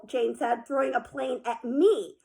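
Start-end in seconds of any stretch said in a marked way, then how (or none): none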